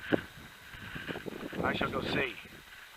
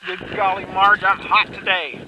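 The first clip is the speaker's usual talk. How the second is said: excited; 4 semitones higher, 11 dB louder than usual. Real, not acted. Excited about shooting well.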